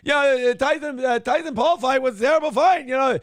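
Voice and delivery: high, indignant impression voice; mock ranting host. Host leading into a drop, high ranting impression. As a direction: build the rant toward a punchline drop. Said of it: "I thought you were gonna do this one".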